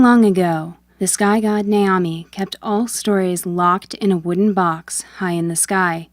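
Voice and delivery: usual speaking voice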